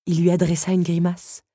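ordinary delivery, nothing marked

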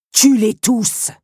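loud, high, merciless order; icy command. Acted. Countess ordering every beetle killed, icily furious. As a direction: drive the order home, cold and forceful. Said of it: "d'une voix glaciale".

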